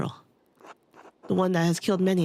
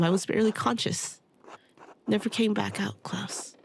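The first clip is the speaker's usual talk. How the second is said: normal talking voice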